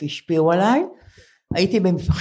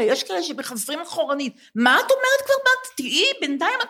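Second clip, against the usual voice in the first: high, incredulous teasing voice; teasing a friend who adds to her age, high and incredulous; mock disbelief; 7 semitones higher than usual. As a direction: squeak it out in mock amazement. Mocking friend's age boasting.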